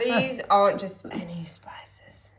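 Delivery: slow breathy luxury ad whisper; Marks and Spencer advert. Acted. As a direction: sell it like a posh food advert. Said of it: "This is gonna sound like a Marks and Spencer's advert".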